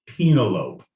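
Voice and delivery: Penelope said as PEE-nuh-lope; Penelope misread phonetically, falling at the end; mispronounced as read. Shown as a demonstration. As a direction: repeat Penelope as three syllables, ending like "lope". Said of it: "you're reading things off a prompter".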